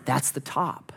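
softly to yourself, hopeful; quiet hopeful thought. Retold or imitated. Hiker believing the ridge is the top, quietly hopeful. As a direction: murmur it, sure it is the top. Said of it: "you look and you think".

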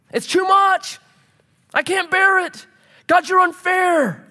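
high, wailing complaint to God; hammed-up anguished protest; Cain protesting. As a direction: cry out in self-pity, dragging the last words. Acts out Cain protesting punishment.

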